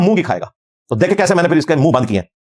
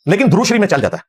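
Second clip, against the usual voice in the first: usual speaking voice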